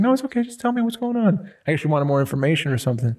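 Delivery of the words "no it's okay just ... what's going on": higher, gentle coaxing aunt; imitating a kind aunt, pitched up. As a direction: coax the child to spill it, lightly voiced. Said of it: "She's like".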